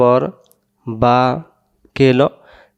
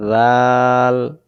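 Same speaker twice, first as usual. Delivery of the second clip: জাল stretched very slow; long drawn-out letter name; much slower than usual. Shown as a demonstration. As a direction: hold জাল out slowly. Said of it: "নরম করে পড়তে".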